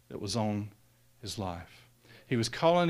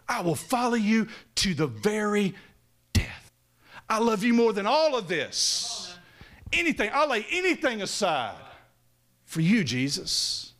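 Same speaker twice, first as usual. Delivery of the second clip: impassioned vow; 8 semitones higher than usual. Retold or imitated. Peter's bold vow, retold.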